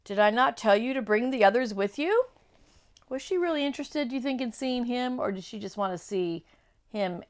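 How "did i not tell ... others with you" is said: fast, loud scolding; angry witch. Acted. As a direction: snap at the failed servant.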